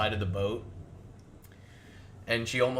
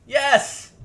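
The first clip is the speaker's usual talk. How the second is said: gleeful cheer; 14 semitones higher, 8 dB louder than usual. Real, not acted. GM cheers at failed roll.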